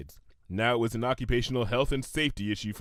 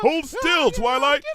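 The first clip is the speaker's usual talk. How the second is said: high, louder urgent order; young dragon shouting at panicking unicorn; urgent call; 7 dB louder than usual. Acted. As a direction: yell it at a flailing friend. Spike swings at thing on head.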